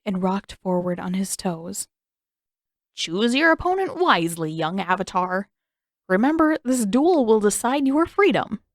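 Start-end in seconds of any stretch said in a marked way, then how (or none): 2.9-8.6 grand, louder villain's pronouncement; menacing king. Acted. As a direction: announce the duel with relish. King's ominous challenge.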